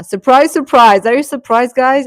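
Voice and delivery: loud, high teasing "surprise"; playful fake announcement; mock surprise. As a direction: sing it out like a big reveal. Mock surprise reveal to viewers.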